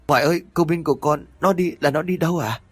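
high, fast frightened plea again; panicked. Acted. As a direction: rush the question in fear. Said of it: "hốt hoảng". (hốt hoảng hỏi dồn, cao và nhanh)

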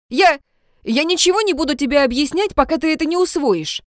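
high, fast furious outburst. A narrator's reading. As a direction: rant it out in tears of rage. Ludwig furious, teary.

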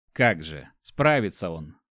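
plain reading voice

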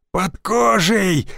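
high, slow, terrified wail; panicked frantic pleading. Acted. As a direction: scream it in panic, slowly. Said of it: "истошно вопил". (панический вопль)

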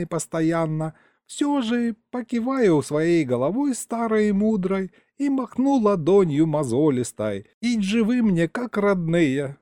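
put-on a rambling elderly grandfather, proud of himself; old grandpa storyteller. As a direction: tell it like a folksy old man, savouring every word. Grandpa continues tale.

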